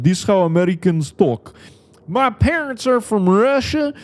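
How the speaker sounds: hammed-up, high-pitched American yokel accent; mock yee-yee American accent. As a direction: mimic a sleeper agent faking a yee-yee American accent. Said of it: "like, kinda like yee yee".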